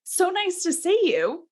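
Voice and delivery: high, sugary sarcastic hello; mock sweet greeting. Bertha greets her husband with mock sweetness. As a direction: greet the husband with fake delight. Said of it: "Like, thrilled about that".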